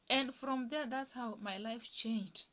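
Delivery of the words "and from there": ordinary talk, nothing marked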